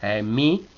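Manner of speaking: "E, mi" dipping on E and rising on "mi"; dip-and-climb tune on "E, mi"; down then up. Shown as a demonstration. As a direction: drop the E, then lift "mi". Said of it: "baja y sube".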